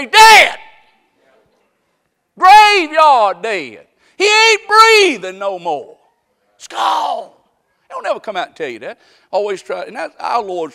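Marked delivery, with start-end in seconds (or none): 0.0-7.3 high, loud, slow shout, dragging graveyard dead; preaching shout. Acted. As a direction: hammer the news home at full force. Preacher voicing blunt death news.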